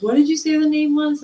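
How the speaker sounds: high, loud, quick astonished ask; sudden high startled question. Retold or imitated. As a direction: blurt it out, shaken. Boss startled by the name.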